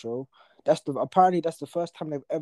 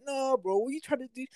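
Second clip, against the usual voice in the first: higher, mocking no bro; mock teacher correction; 11 semitones higher than usual. School friends correcting a wrong answer, lightly mocking. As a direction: mimic a schoolmate correcting you. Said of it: "they'd be like".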